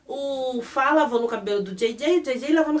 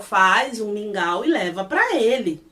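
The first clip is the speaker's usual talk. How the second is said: usual speaking voice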